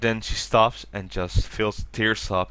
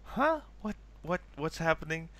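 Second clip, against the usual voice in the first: mock confusion; 6 semitones higher, 8 dB quieter than usual. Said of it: "And Yuko's like".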